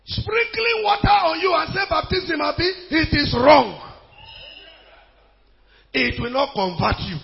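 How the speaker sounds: high-pitched, forceful denunciation; impassioned revival preacher; fiery preaching. Real, not acted. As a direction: hammer the doctrine home to the church. Preaches at full force against infant baptism.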